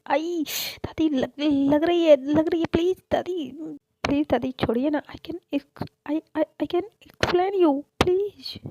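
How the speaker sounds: high, loud yelps of pain begging to be let go; pained pleading. Acted. Grandson begs grandma to let go of his ear, pained high pleading. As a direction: whine like your ear is being twisted.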